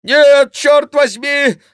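high, loud, drawn-out refusal; monk's yell. A narrator's reading. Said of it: "закричал Гранфло". (протяжный крик протестующего монаха)

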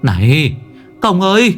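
calling out. Acted. Young master shouting to the monks to spare the ghost children. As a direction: cry out to stop the slaughter. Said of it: "Cậu kêu lên".

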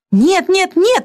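high, frantic cry; frantic outcry. A narrator's reading. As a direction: cry out as if half mad. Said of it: "вскричала, как безумная". (исступлённый высокий крик)

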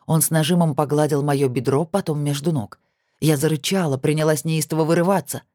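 plain reading voice

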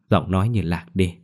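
usual speaking voice

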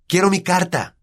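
put-on high, demanding boy's cry; boy yelling for his letter; shouting. A narrator's reading. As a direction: shout for what is yours. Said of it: "gritó".

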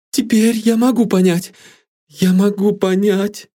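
high, agitated realisation; overwrought, high-strung voice; feverish. A narrator's reading. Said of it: "подтвердила она лихорадочно".

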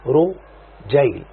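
رجيل stretched very slowly, u then ay clearly shaped; slow diminutive demo. Shown as a demonstration. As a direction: draw out رجيل as a model. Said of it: "أضم الحرف الأول وأكسر ما قبل الآخر". (نطق رجيل ببطء شديد للتوضيح)

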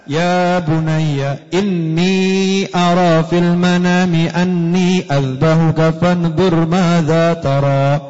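very slow, drawn-out tender recitation; gentle recitation. Acted. Prophet Ibrahim tells his son gently and very slowly. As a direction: recite slowly like a loving father. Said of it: "Ayahnya ngomong jelas-jelas".

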